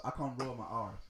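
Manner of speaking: ordinary talk, nothing marked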